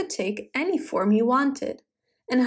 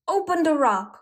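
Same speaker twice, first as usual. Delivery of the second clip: raised-pitch command; mild storybook roar; 6 semitones higher than usual. A narrator's reading. Wizard ordering the shepherd at the cliff, mild roar. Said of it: "roared the wi".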